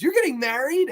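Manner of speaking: high, stunned repeat of the question; shocked disbelief again. Retold or imitated. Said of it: "He asks her about five times".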